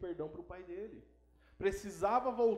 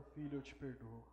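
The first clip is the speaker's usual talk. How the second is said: low, very soft, tender forgiveness; subdued, near-murmured pardon; retold tearful forgiveness; 6 semitones lower, 10 dB quieter than usual. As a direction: imitate a moved father, hushed and low. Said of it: "começou a chorar e falou".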